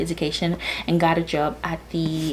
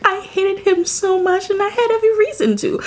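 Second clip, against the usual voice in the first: much higher, insistent; every reason to hate him, pushed up high; emphatic rant; 13 semitones higher, 4 dB louder than usual. Real, not acted. Ranting about hated character.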